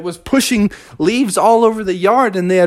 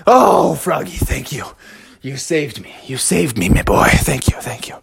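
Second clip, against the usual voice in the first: a relieved father murmuring thanks; grateful dad; 4 semitones lower than usual. Acted. Dad thanks Froggy after hose spray.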